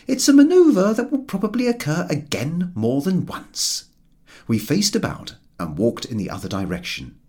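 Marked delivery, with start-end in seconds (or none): 0.1-3.9 higher, pointed sarcasm; a lightly voiced sardonic companion; George's dry voice. A narrator's reading. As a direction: lay the correction on dryly.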